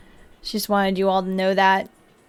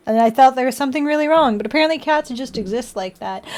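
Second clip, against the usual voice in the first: normal talking voice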